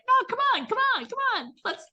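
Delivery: a puppy begging to play; squeaky high dog voice urging come on; pleading dog voice. Pretend. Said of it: "they're like".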